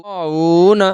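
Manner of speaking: slow "غاوون" with long aa and oo, short final na; drawn-out recitation. Shown as a demonstration. Quran teacher reading out the word, "غاوون" slowly drawn out. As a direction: stretch both long vowels of "غاوون". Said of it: "الف مدہ کو ایک الف کے برابر کھینچ کر پڑھیں".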